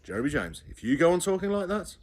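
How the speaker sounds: a mother losing patience; stern warning. A narrator's reading. Mother threatening child.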